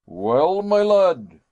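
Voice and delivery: loud, hearty greeting call; jolly professor calls out loud; cheerful cry. A narrator's reading. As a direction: boom it out with glee. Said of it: "he cried".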